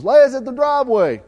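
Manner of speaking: loud, high call across the yard; hollering. Retold or imitated. Wife calling out that the dog is back, a loud holler. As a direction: shout it out like calling from the house. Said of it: "Jeanette hollered at me and goes".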